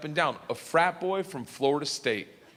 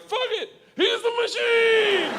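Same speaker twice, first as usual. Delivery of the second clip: high, loud, slow chant; drawn-out crowd yell; group shout; 5 dB louder, slower than usual. Retold or imitated. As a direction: gangsters cheer him on, roaring. Gangsters shout back together.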